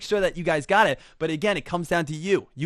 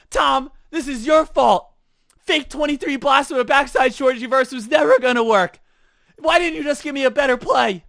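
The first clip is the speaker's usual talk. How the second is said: mock furious rant at the star; angry complaint; 6 semitones higher, 7 dB louder than usual. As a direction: yell at the quarterback who let you down. Injured player blames Tom Brady.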